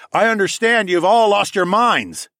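high, loud, exasperated retort; loud rebuke. A narrator's reading. Hero scolding the mob, loud rebuke. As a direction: fire it back at the whole crowd.

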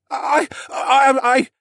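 high, loud startled stammer; stammering boy. Acted. Startled stable boy stammers at Alex, high and halting. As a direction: trip over every I. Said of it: "he stammered horribly".

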